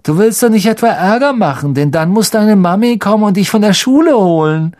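high, loud mocking bully voice; taunting. Malfoy taunts Ron about his mother. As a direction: rub it in with a smirk.

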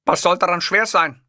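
Hitler voice. Acted. Hitler insisting to the hotel clerk, imperious. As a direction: speak as the offended Führer, lofty.

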